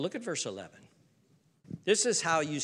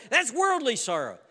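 ordinary delivery

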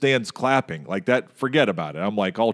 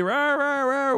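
high-pitched nonsense rant; mock angry yelling; slower than usual. Irate driver at the lady who hit his car, mock yelling. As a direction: imitate a man ranting, far higher than usual voice. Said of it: "He's yelling at the lady".

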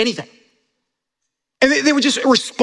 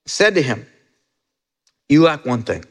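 scripture reading; 11 semitones lower, faster than usual. A narrator's reading. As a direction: read it gently and low, with space between phrases. Reading Jesus' words.

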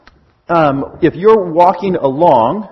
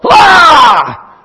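sudden high loud "Ah!"; mock scare yell, high and loud; jump-scare shout; 9 semitones higher, 8 dB louder than usual. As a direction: leap out and yell at them. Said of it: "pop out of the shadows and go".

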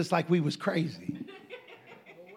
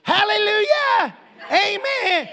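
high loud slow hallelujah from across the store; Ted shouting praise; 12 semitones higher, 6 dB louder, slower than usual. Retold or imitated. Friend Ted answers from across the store, shouting. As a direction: call out amen joyfully. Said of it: "He's like".

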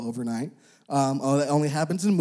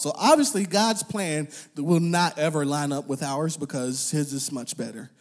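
normal talking voice

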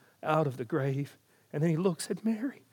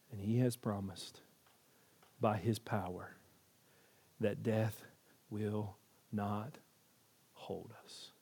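much softer, lower, slow and spaced; hushed preaching; 6 semitones lower, 11 dB quieter than usual. Acted. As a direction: lean in and let each word land. Preacher drops to a hush.

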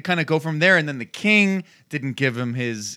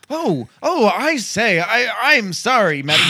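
mock British accent; 5 semitones higher than usual. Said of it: "supposed to be British".

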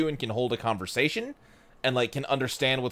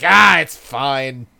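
loud drawn-out mock shrug; carelessly brushing it off; dismissive; 3 semitones lower, 7 dB louder, much slower than usual. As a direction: wave it off loudly, stretching the words. Acting out Mai brushing it off.

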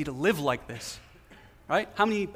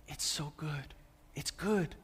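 soft admiring; 2 semitones lower, 8 dB quieter, slower than usual. Acted. God admiring his creation.

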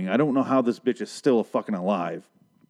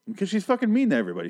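ordinary talk, nothing marked

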